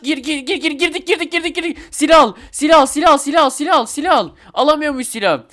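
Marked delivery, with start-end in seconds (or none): 0.0-4.3 high, frantic rapid-fire repeats; frantic urging. Real, not acted. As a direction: urge it on in a rising panic of excitement. Frantic rush into armory in game. (heyecanla, telaşla tekrar tekrar)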